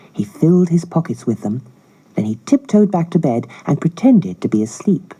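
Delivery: usual speaking voice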